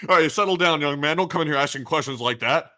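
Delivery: high, loud mock scolding doctor; stern doctor voice. Voicing doctor's reply.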